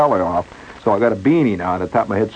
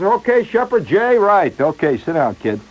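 brisk photographer; 9 semitones higher than usual. Retold or imitated.

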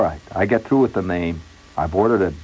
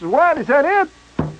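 astonished; slower than usual. Retold or imitated. Astonished at quick photo.

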